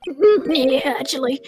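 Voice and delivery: ordinary talk, nothing marked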